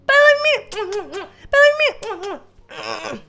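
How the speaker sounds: high, loud playful bye; excited bye. Pretend. Closing the vlog for viewers, high loud squealed bye. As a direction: squeal the goodbye.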